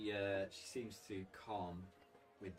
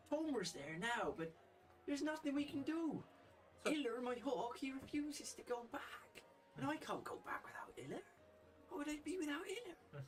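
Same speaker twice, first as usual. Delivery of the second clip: distressed Frida. Acted. Frida despairs to Trix about her hawk, very high and pleading. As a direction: wail it out, lost without her hawk.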